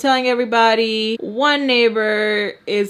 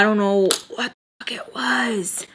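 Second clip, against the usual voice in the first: breathy unvoiced aside; whispered; slower than usual. Real, not acted. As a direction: whisper it to yourself.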